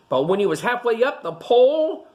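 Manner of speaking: usual speaking voice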